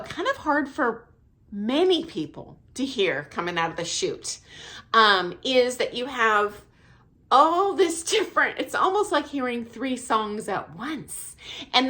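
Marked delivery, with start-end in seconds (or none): none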